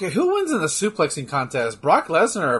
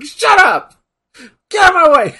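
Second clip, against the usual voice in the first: yelling, much higher and louder; angry shout; 13 semitones higher, 8 dB louder than usual. Retold or imitated. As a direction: bark it out at pestering customers. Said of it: "he just gets in there like".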